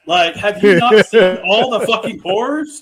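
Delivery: venting at Beth for refusing the gun; exasperated rant. Retold or imitated. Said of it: "It's like".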